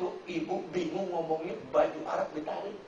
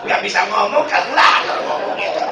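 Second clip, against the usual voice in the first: punchline shout; 2 semitones higher, 12 dB louder than usual. Real, not acted.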